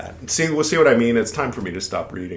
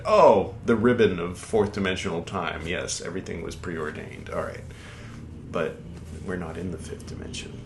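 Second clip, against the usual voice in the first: ordinary talk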